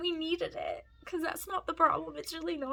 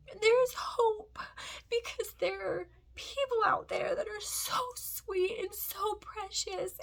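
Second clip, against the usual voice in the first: real tears breaking through; crying; 5 semitones higher than usual. Real, not acted. Mom telling viewers there's hope, openly crying. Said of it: "that's not why I'm crying".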